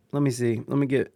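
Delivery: ordinary delivery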